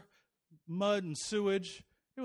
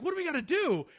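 mock panic; 10 semitones higher, 4 dB louder than usual. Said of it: "the rest of us are like".

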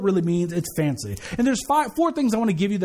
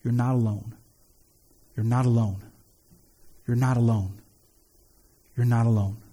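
gentle whisper; 11 semitones lower than usual. Shown as a demonstration. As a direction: murmur it close to a dying person's ear. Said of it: "I just whisper in their ear".

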